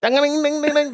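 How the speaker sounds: high sung "ding ding" jingle; mock Indian news jingle. Shown as a demonstration. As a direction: sing the jingle with a twangy Indian flavour. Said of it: "That's more Indian".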